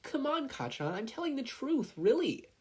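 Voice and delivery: put-on high, pleading little boy; pleading child. Izuku pleads with Kacchan to believe him, high and earnest. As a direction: beg him to believe you.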